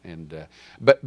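ordinary talk, nothing marked

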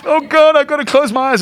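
high, loud cry to God; overwrought, high-pitched plea; mock panic. Flustered beachgoer praying to God, mock panic.

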